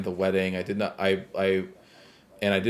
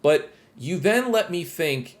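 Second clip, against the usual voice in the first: annoyed rant; 6 semitones higher than usual. Real, not acted. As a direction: vent the irritation, voice pushed up high. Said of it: "the only thing that pissed me off".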